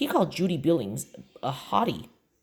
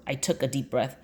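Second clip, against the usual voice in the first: ordinary delivery, nothing marked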